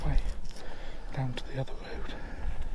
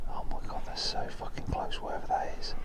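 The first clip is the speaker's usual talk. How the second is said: whispering. Real, not acted. Fearful talk near the noise.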